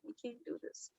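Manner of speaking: retold, breathy near-silent whisper through tears; tearful whisper. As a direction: whisper it as if about to cry. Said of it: "I started crying".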